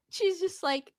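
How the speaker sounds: ordinary delivery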